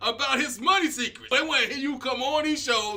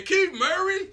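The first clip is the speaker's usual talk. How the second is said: incredulous; 10 semitones higher, slower than usual. Real, not acted. Incredulous repeat of the name.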